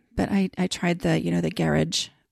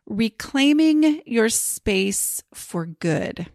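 slow title announcement; 2 semitones higher, much slower than usual. Shown as a demonstration. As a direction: say each word slowly, like a title. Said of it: "I think I'm gonna call it".

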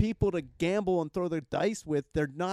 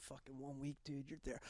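whispering; 20 dB quieter than usual. Retold or imitated. Mocking friend's sympathy.

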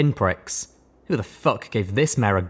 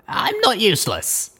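higher mare voice, held steady; Blackjack voice; 13 semitones higher than usual. A narrator's reading. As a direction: keep it even and controlled. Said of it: "I answered as evenly as I could".

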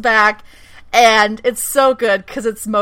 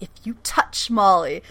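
usual speaking voice

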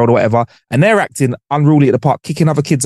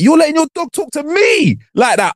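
indignant retort; 12 semitones higher than usual. Retold or imitated. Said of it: "I'm like".

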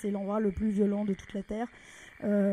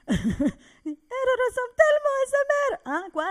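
much higher, louder, slow; gushing old lady; 7 dB louder, slower than usual. Retold or imitated. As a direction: gush like a moved grandmother. Quoting stunned grandmother. (grand-mère émue, très aiguë et lente)

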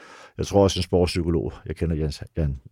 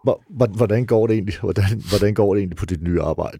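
imitating a tentative, polite inquiry; acting out dutiful small talk; mock polite; 3 semitones higher, 4 dB louder than usual. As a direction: stumble into a courteous question, slightly louder and higher. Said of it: "Jeg prøver bare at være høflig".

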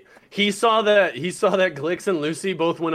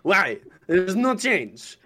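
put-on waiter in a thick French accent, a touch higher and flustered; 4 semitones higher than usual. Waiter answers the confused party in a thick French accent. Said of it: "This is the thickest accent that you have ever heard".

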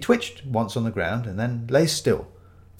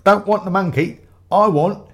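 loud, high-pitched insistence; demanding customer; 8 semitones higher, 8 dB louder than usual. Acted. Ramage refuses to deal with the underling, loud and demanding. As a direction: insist on dealing with the top man.

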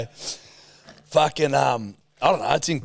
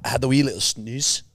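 Scottish accent. Shown as a demonstration. Co-host coaching the wee snooze, in Scottish. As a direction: model Scottish lilt. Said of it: "Like a Scotsman".

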